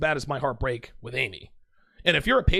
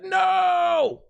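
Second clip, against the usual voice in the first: dramatic shout; 4 dB louder than usual. Retold or imitated. Inner cry on being disarmed.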